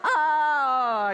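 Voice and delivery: high, loud scream; hammed-up wail sliding downward; cried-out scream. A narrator's reading. Everybody sees the secateurs, screaming. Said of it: "everybody cried".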